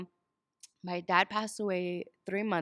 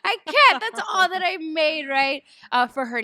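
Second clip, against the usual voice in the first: much higher and louder, a retold wail; distraught outburst; 8 semitones higher, 10 dB louder than usual. Exhausted new mom snaps back at her husband, mock frantic wail. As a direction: replay her own frantic cry of despair.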